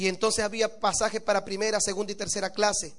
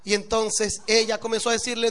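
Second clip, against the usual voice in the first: ordinary talk, nothing marked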